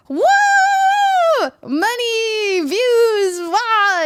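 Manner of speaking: high, drawn-out wails of excitement; hyped-up crowd. Retold or imitated. Everyone hyped on money and views, mock-ecstatic cheering. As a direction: whoop each word long and high. Everyone's overexcited hype imitated.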